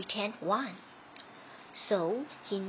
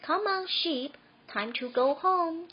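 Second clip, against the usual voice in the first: calling the sheep; 7 semitones higher than usual. A narrator's reading.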